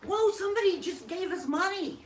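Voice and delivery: high, loud real excitement; excited surprise. Real, not acted. Co-host announcing a viewer's donation, excited surprise. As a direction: exclaim it, thrilled by an unexpected windfall.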